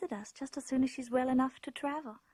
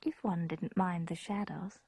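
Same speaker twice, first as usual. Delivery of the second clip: reflective storyteller narration; story narration; 4 semitones lower, 3 dB quieter than usual. A narrator's reading. Grown woman recalling her childhood castle, hushed narration. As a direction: tell it as a memory tinged with dread.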